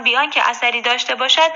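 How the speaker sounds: plain reading voice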